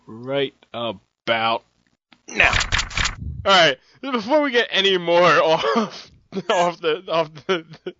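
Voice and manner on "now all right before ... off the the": laughing through the words, louder and higher; can't get the sentence out for laughing; cracking up mid-line. Real, not acted.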